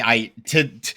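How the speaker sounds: ordinary talk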